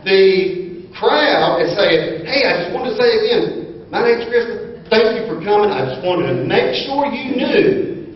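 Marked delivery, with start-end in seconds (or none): 2.3-8.1 high, breathless rush of welcome; rapid gushing greeter. Retold or imitated. Man retelling greeter Krista's rapid-fire welcome. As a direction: rattle it off like an excited greeter. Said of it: "I don't know if I said that fast enough".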